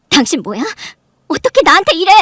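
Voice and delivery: loud, pitch leaping up at the end; outraged confrontation. Acted. Wife grabbing her husband by the collar, outraged. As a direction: confront him, building to a loud cry of betrayal.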